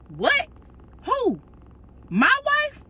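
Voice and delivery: outraged disbelief building to the last word; mock shock. Ex-husband hearing news of his ex-wife, rising mock shock. Ex hears she's pregnant.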